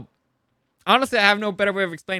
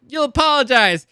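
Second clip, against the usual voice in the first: higher, louder stern command; stern mother; 6 semitones higher, 7 dB louder than usual. Retold or imitated. Mother ordering Campion to apologize, mock stern command. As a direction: lay down the law like a cross parent. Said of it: "And Mother's like".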